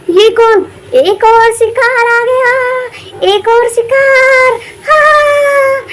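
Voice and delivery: slow, high, gloating witch. Acted. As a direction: stretch it out, hungry for prey. Witch spots new prey.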